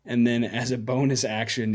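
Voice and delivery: usual speaking voice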